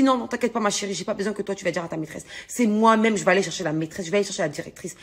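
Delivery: plus bas et plus doux, pour calmer sa fille; rassurante. Retold or imitated. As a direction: parle doucement à sa petite fille. (voix douce et rassurante de maman)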